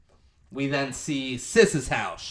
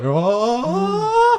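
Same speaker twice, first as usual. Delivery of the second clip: high crying oh; moved oh; 8 dB louder than usual. Retold or imitated. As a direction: let it crack with emotion. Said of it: "you're like".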